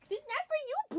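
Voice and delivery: high squeaky pet voice. Real, not acted. Owner telling her pet it's not for it, baby voice.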